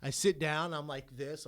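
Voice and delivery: usual speaking voice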